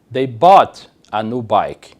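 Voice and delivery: very slow, careful English; slow model sentence. Shown as a demonstration. Teacher reads example sentence slowly to class. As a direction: read it slowly for learners. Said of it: "Bought é o passado de buy".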